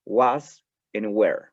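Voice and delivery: "was and were" said slowly, "was" stressed falling; slow model words. Shown as a demonstration. Teacher introduces "was" and "were" slowly to students. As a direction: draw out "was" then "were". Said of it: "vamos a utilizar dos términos".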